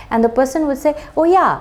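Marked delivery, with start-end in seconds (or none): 1.2-1.5 quick, higher, louder "oh yeah"; eager HR manager. Retold or imitated. HR manager answering Jennifer's call, bright and eager. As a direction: play the keen manager on the phone.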